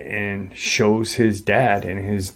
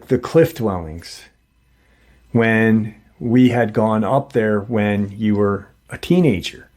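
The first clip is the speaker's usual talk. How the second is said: plain reading voice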